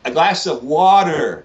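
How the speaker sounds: put-on slow, deliberate American-accented water. English guest retrying his diner order, slow American accent. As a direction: spell out water with a hard American r. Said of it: "I'd be like".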